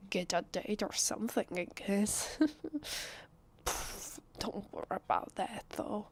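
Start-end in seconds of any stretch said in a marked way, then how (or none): 2.4-3.2 high, light giggle; awkward laugh. Acted. Guest admitting a friend's matchmaking, awkward high laugh. 3.6-4.2 soft, airy scoff; dismissive puff. Acted. Guest dismissing talk of a date, dismissive puff.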